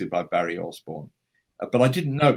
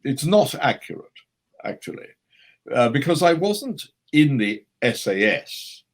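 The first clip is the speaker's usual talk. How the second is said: emphatic grand-actor impression, swelling louder; Christopher Lee; 4 dB louder than usual. Actor on the phone correcting the story, mock imperious. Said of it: "I've got Christopher on the phone".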